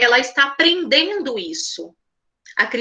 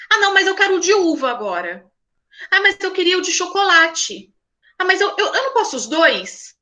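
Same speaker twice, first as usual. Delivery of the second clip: lightly voiced whiny child, higher pitch; indecisive child; 6 semitones higher than usual. Indecisive child switching ice cream flavours, mild childish whine. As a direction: play a fickle six-year-old changing his mind.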